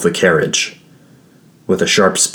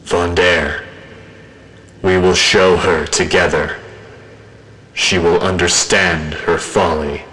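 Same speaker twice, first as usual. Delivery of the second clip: a thousand voices speaking as one; distorted many-voiced chorus. A narrator's reading. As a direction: speak as an eerie, distorted collective. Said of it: "I heard a distorted voice, like a thousand people speaking as one".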